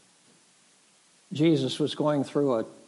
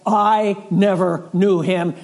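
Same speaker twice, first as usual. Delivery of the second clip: loud, slow, raised-pitch oath; sworn denial; 4 semitones higher, 6 dB louder, slower than usual. Retold or imitated. Peter swears with an oath he never knew Jesus, vehement. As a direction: hammer out each word as if swearing. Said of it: "Peter swore with an oath".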